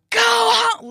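high yelled command; imitating a woman yelling at a dog; loud yell. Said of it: "like, really loud".

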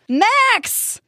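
high-pitched desperate call; yelling. A narrator's reading. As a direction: call out for a lost friend. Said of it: "yelling into the night".

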